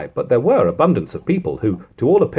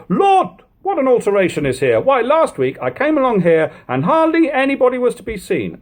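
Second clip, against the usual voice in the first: high, amazed exclamation; astonished stranger; 13 semitones higher than usual. A narrator's reading. Stranger in Aldgate marvels at the street, high and amazed. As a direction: cry out in wonder at the changed street. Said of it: "expressing their surprise".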